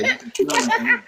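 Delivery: high, exaggerated British accent put on to taunt; mock British accent. Mocking a British guest on the live stream, high mock British accent. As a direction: parrot her English accent in a high, prissy pitch. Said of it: "Mock my accent".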